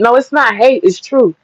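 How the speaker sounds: ordinary talk, nothing marked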